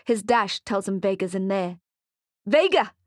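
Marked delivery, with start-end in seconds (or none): 2.4-2.9 loud, high shouted name; shouting. Acted. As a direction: yell her name across the shop. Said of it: "Mali shouts".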